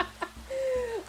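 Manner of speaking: high-pitched, giggly disbelief; laughing bafflement. Real, not acted. Laughing after baffling quote.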